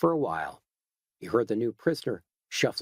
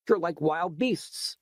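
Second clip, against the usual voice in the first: slow, higher, contemptuous; disgusted; 5 semitones higher, slower than usual. Acted. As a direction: spit out the insult slowly. Edgar raging at McManus.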